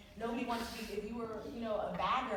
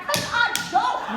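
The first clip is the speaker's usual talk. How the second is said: much higher and louder, a defiant retort; mock snapped refusal; 14 semitones higher, 14 dB louder, slower than usual. She retorts to the stranger, higher and louder. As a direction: fire it back, final and stubborn. Her snapped reply in retold argument.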